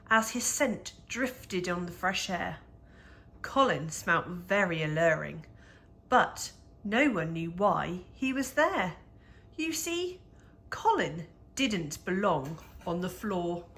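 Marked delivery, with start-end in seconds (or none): none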